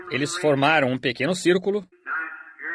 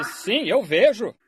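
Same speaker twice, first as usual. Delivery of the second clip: slow, high, drawn-out reply; retold wonder, pitched up and stretched out; amazed answer, lightly acted; retold amazement; 7 semitones higher, slower than usual. Healed blind man answers his wife.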